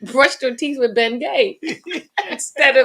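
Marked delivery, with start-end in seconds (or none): 1.6-2.3 high laugh. Real, not acted. Laughing at Bengay mix-up.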